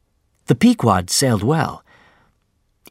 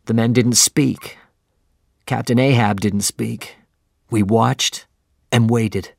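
usual speaking voice

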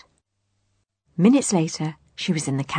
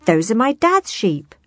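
higher, louder indignant claim; fuming; 5 semitones higher, 5 dB louder than usual. A narrator's reading. Farm girl claims her father's flock, fuming. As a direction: build in indignation to the end. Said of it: "fumed Harriet".

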